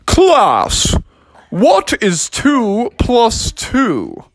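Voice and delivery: slowly quizzing the class; teacher slowly asks the class. Acted.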